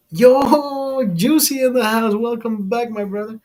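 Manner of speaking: high, drawn-out excited "yo"; hollered greeting. Real, not acted. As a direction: hail a friend as they turn up. Calling out greeting to arriving friend.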